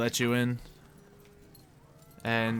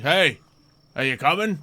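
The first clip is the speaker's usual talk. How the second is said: raised, higher call down the hallway; warden calling; 5 semitones higher, 7 dB louder than usual. Acted. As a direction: call back to a straggler. Warden calls to player.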